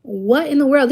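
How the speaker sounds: outraged parent reacting; retold shock. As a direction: gasp it out in disbelief. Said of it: "we're like".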